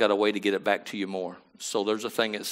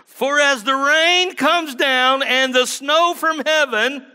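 high, loud, slow proclaiming of the verse; preaching scripture; 13 semitones higher, 7 dB louder, slower than usual. Acted. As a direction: ring the scripture out to the church. Preacher proclaims scripture at full force.

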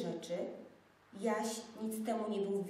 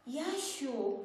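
wyższe, przestraszone zawołanie; zawołaj chłopca w nagłym strachu; z przestrachem; 5 semitones higher than usual. A narrator's reading. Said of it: "zawołała z przestrachem dziewczynka". (przestraszony okrzyk dziewczynki)

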